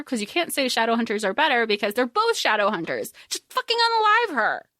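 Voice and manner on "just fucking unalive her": pitched way up, venting hard; a fan losing patience with her favourite character; high ranting outburst. Real, not acted. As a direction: blurt it out high and fed up.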